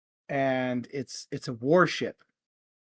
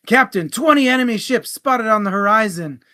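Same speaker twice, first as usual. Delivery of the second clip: higher, louder urgent report; alarmed first mate; 6 semitones higher, 8 dB louder than usual. Acted. First mate warns captain of twenty ships, loud raised alarm. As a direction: call it out like the deck is in danger.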